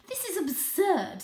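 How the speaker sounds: high, slow, scoffing aunt voice; scornful aunt cat. Acted. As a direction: sniff it off as nonsense. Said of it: "said Aunt Junie".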